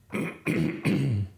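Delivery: rough throat clear. Real, not acted. Clears throat between sentences.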